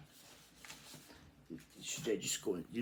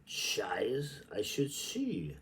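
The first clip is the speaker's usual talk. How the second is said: slow, lilting recital of Joyce; poetic reading; 2 semitones higher, 6 dB louder, slower than usual. Acted. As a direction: read it like an incantation. Reads Joyce aloud.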